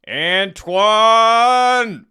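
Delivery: long, high shout of the name; drawn-out shout. Acted. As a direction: belt it out high and hold it. Opening shout after countdown.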